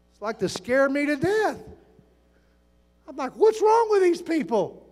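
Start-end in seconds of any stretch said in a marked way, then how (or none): 3.4-4.9 high-pitched, stretched mock outrage; retold exasperation. Visitor startled by screaming in church, exasperated. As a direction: act out baffled alarm, pitched way up.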